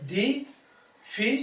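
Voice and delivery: teacher separating each syllable of difficult; split into syllables. Shown as a demonstration. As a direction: leave a long gap between the three parts of difficult. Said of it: "how many natural division of sounds".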